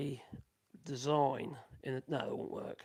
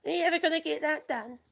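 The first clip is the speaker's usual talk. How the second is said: high, louder nagging wife voice; mock-nag like his wife; mimicking the missus; 12 semitones higher, 6 dB louder than usual. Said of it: "the missus keeps saying".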